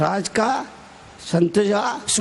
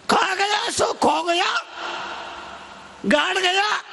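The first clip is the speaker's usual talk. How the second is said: high-pitched ringing declamation; calling out; 8 semitones higher than usual. Real, not acted. Preacher hammering home a saying, calling out.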